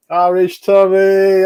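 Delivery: drawn-out call. Real, not acted. Calling out a chat regular.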